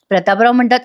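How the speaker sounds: plain reading voice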